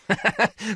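high, loud hearty laugh. Acted. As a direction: burst out laughing loudly. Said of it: "Varon laughed loudly".

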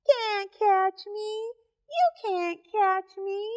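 high, slow taunting monkeys; sing-song taunt, high and slow; teasing monkey voice. Acted.

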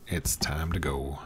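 coaxing whisper. Real, not acted. Coaxing the pig softly.

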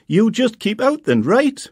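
high, loud telling-off; mother snapping at her son from the door; scolding mum. A narrator's reading. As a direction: scold him for not waiting.

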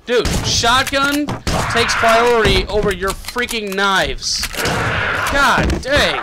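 loud, high complaint; exasperated rant. Real, not acted. Player complaining to knife-throwing enemy, exasperated rant. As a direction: argue back, punching out the words. Complaining after game death.

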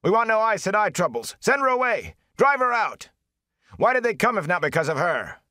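men shouting at the Aes Sedai; crowd shouting. A narrator's reading. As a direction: yell from the safety of the crowd. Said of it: "shouted then".

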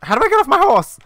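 very high, loud panicked question; frantic yell. Real, not acted. Player stuck on horse as time runs out, frantic yell.

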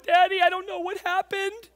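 put-on high, loud, upset child's wail; high-pitched panicked kid impression; tearful daughter. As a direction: imitate a distraught daughter pleading with dad. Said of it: "tears streaming down her face".

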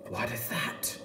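breathless whispered gasp; hushed whisper. Pretend. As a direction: whisper it in wonder.

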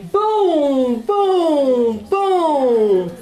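loud booming thunder; big stormy booms. Acted. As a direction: boom it out like thunder. Thunder sound in story.

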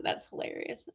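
breathy whispered aside, barely voiced. Real, not acted. Reacting to a viewer's comment, a whispered amused aside. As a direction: say it under your breath, amused.

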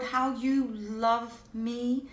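usual speaking voice

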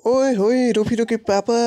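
high, sing-out "oye hoye" greeting; teasing hail. Acted. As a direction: tease him with a high-pitched call. Acquaintance teasingly hails the father.

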